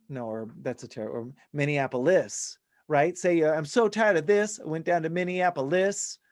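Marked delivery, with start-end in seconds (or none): 1.5-2.6 "Minneapolis" with a stressed LIS at the end; unnatural stress. Shown as a demonstration. Songwriter showing a forced rhyme, "Minneapolis" ending on LISS. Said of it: "You never wanna say". 3.5-6.2 higher, "Minneapolis" ending on a stressed LISS to match "this"; singsong couplet landing hard on the end of "Minneapolis"; forced rhyme stress. Shown as a demonstration. Songwriter mocking a bad couplet, "Minneapolis" as minneapo-LISS. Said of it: "nobody says Minneapolis".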